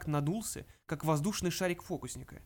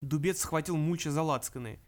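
ordinary delivery, nothing marked

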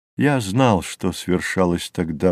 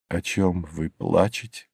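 quiet, slightly lowered narrator's question; hushed, low-key question; soft question; 3 semitones lower, 7 dB quieter than usual. A narrator's reading. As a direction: ask it softly and gently. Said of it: "спросил я".

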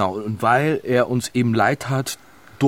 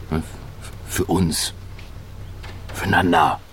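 hollow reassurance; 7 semitones lower, 6 dB quieter than usual. Acted. Woman soothes the group with strained, soft reassurance. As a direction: soothe them into pretending all's well.

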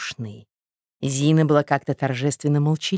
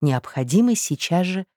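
usual speaking voice

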